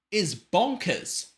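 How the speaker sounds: high, drawn-out amazement; amazed. Real, not acted. Amazed at her breath control.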